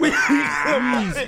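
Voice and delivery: high alien voice breaking into laughs; laughing alien voice. Pretend. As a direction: alien voice cracking up. Repeat of alien line, laughing.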